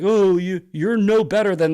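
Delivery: loud nagging parody of a critic; mocking critic. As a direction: sneer at the pearl-clutchers. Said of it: "It's like".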